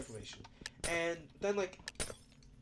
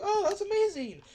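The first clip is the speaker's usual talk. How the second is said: high, loud mock delight; over-the-top sarcastic amazement; 9 dB louder than usual. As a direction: fake cheer dripping with sarcasm. Sarcastic retold thought.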